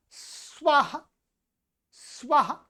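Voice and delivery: स्वः as a short sva with a light 'h'; short breathed ending. Shown as a demonstration. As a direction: cut स्वः short, no long 'haa'. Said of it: "स्वाहा नहीं है". (स्वः छोटा, हल्के ह के साथ)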